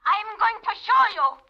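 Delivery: a threat that builds to a shout; furious threat. Acted. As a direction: vow revenge through the teeth. Dancer threatens Paul.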